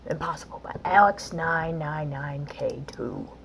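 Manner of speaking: whispered level title. Real, not acted. Gamer whispering the level name to viewers.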